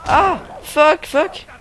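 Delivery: sudden high panicked yelps and swearing; panicked yells. Real, not acted. Gamer yelping as he gets hit, panicked. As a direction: yelp in real alarm.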